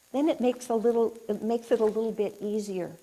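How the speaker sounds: ordinary talk, nothing marked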